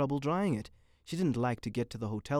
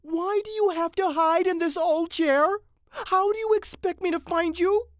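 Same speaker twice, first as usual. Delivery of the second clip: scolding wife; 9 dB louder than usual. Acted. Mrs. Mitty finding her husband, scolding loudly. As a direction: nag in a high, exasperated voice.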